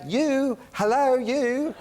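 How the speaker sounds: high, drawn-out British greeting. Retold or imitated. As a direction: hail someone slowly in a high, camp-star caricature. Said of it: "[name] is like".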